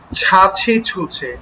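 ছাছি ছুছে slow, each ছ breathy aspirated; aspirated chh demo. Shown as a demonstration. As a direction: stretch out ছাছি ছুছে with a strong puff of air. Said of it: "এই দুটোর পার্থক্য স্পষ্টভাবে ব্যক্ত করতে হবে".

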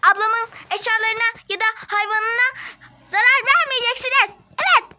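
loud, high threatening scold; angry warning. Acted. Girl warns staff off her sister's things, shouting high. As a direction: warn them fiercely off.

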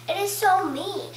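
normal talking voice

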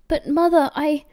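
urgent son's protest. A narrator's reading. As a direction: start to plead, then break off. Son protests, gets cut off.

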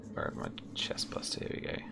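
softly muttered to himself; whispered aside. Real, not acted. GM searching his notes, whispered aside.

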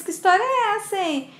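high-pitched, acted demand for an explanation; indignant surprise. Daughter confronts father over wedding news, indignant surprise. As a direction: question it in mild disbelief.

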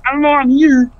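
lightly voiced frog puppet; Kermit the Frog. Friend shows off his voices with a light Kermit impression. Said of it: "one of my many voices".

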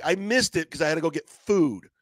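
ordinary talk

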